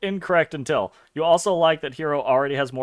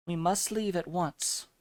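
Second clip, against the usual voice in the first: put-on softer, solemn girl from another world; mysterious girl; 3 semitones higher, 8 dB quieter than usual. Lucia urging Hero to leave, soft and solemn. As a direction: voice a grave, quiet game heroine.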